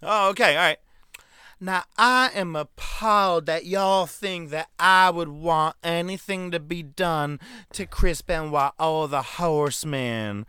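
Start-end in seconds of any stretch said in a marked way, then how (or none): 1.6-10.5 higher, slow put-on Southern lady; mock Southern belle. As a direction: drawl it out as a fake sweet Deborah. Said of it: "Deborah speaks yet again".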